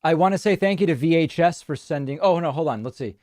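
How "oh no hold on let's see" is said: normal talking voice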